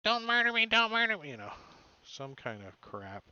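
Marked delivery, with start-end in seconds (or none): 0.0-1.3 high, loud panicked squeal; shrill-pitched cry for mercy, hammed up; screaming plea. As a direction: scream it like a terrified little minion.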